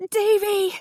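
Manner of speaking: high, piercing scream of a name; mother screaming her son's name. Acted. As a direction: scream his name in panic. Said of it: "she screamed".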